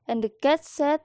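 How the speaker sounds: plain reading voice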